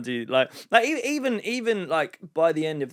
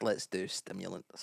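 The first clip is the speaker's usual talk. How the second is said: hushed conspiratorial; 7 semitones lower, 14 dB quieter than usual. Retold or imitated. Mocking late-night party talk.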